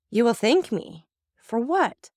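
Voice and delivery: high, incredulous question; stunned disbelief. Acted. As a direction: ask in shocked disbelief. Wife stunned by husband.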